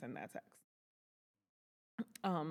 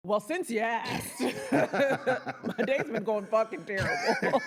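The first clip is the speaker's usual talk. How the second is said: slow, louder, laughing sarcastic rant; mock honest complaint; 4 semitones higher, 7 dB louder, slower than usual. Answering Charles's how-are-you with a slow laughing mock rant. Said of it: "You're not gonna be like".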